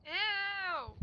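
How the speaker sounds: loud, high disgusted ew. Real, not acted. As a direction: recoil in disgust. Disgusted reaction to gross thing.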